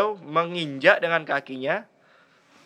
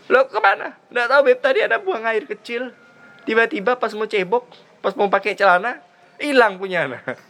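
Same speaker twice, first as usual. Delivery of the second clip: raised pitch, excited telling; comic dialogue; 8 semitones higher, 3 dB louder than usual. Acted. The man explains the loss to the Habib, flustered. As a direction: tell it in a worked-up voice.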